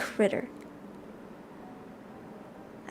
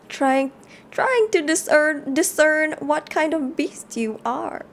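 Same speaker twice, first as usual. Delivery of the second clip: slow, somewhat higher granny voice; slowly, like a wise old grandmother; a light put-on grandma; 4 semitones higher, slower than usual. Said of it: "I'm figuring my grandma voice".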